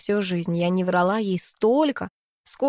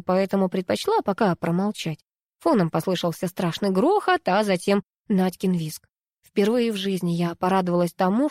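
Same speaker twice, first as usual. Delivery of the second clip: ordinary delivery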